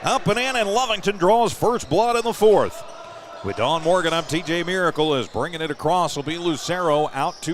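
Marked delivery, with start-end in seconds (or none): 0.0-2.8 higher and louder, building on the score; excited play-by-play. Real, not acted. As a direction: ride the fast break up to a shout. Fast break score called live.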